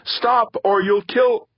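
high, loud scream cut off mid-word. A narrator's reading. Comm center pleading as it is destroyed, screamed. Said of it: "screamed the voice".

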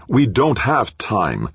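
impatient boss snapping at the team; snapped. A narrator's reading. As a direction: cut him off hard and slow. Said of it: "McClintock snapped".

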